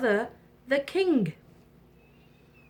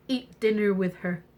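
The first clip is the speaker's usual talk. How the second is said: plain reading voice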